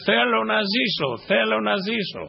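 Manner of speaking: mock cry of the sick girl begging to live; retold pleading cry. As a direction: lift the voice high, pleading to live. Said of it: "φώναζε".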